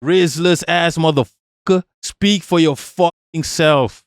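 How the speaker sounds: ordinary talk, nothing marked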